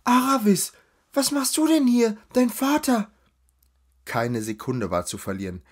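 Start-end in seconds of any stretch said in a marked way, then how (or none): 0.0-3.1 high, loud surprised call. Acted. A lady spotting her old friend in the crowd, loud excited call. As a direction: shout her friend's name across the street. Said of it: "rief laut".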